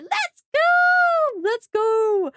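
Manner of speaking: high shouted cheer; excited cheer. Real, not acted. Streamer cheering about game progress. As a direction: shout it like a victory.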